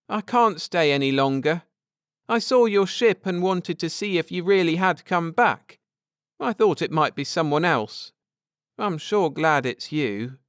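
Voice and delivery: put-on boy speaking a little brightly and hurried; young Tommy. Tommy telling Ned and Cynthia he must go, lightly voiced boy.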